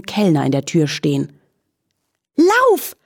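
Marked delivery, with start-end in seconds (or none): 2.4-3.0 whinnied shout. A narrator's reading. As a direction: yell to run like a startled horse. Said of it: "wieherte sie dem Floh zu".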